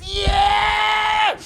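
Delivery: very high, drawn-out cheer; mock crowd hype. Comic on imagined Australian tour, high mock cheer. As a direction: squeal out a long rock-show yell. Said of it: "And I'll be like".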